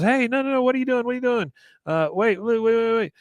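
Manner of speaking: high-pitched mock girl protesting; alarmed protest. Serena being blindfolded for the game, high alarmed protest.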